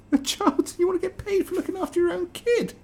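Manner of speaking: high, squeaky incredulous question; can't believe what you're reading; shrill disbelief. Real, not acted. Mocking disbelief at article.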